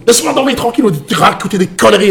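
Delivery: high, loud mock grumble; irritated girlfriend. Woken girlfriend dismissing her boyfriend's story, acted irritation. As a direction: imitate a woken-up girlfriend telling him off. Acting girlfriend's annoyed answer.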